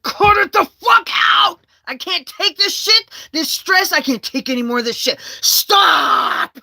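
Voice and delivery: high, full-force re-enacted scream; loud outburst at breaking point. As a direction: scream it as if possessed. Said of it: "when I screamed at him".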